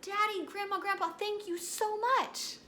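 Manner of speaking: high, gushing thank-you; thrilled, grateful child. Pretend. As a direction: act like a child overjoyed with a present. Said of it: "you might say".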